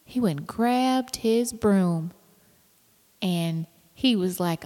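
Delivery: normal talking voice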